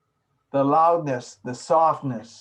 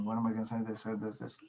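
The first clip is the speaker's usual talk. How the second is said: fast, softer thinking to oneself; hurried inner monologue; 3 semitones lower, 10 dB quieter, much faster than usual. Acted. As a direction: rush it quietly like a racing thought. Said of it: "You're just in your mind saying".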